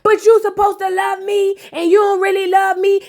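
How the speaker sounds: [name] pleading with Fabo, high mock whine. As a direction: plead that he doesn't love her, hammed up.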